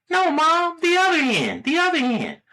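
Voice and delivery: put-on insistent high-pitched kid; very high small-boy voice, repeating insistently; little boy. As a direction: correct her in a tiny child's voice. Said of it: "the little boy asks his mother".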